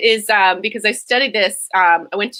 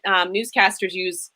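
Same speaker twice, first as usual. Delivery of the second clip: ordinary talk, nothing marked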